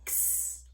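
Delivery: X as a soft whispered ks; X sound. Shown as a demonstration. Teacher modelling the X sound in words, whispered ks. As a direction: hiss out ks for X. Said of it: "it says that X".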